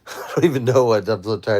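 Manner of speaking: laughing it off, tired and confused; a sleepy, bewildered laugh through the words; giggling at herself. Real, not acted. Laughing at not knowing her age.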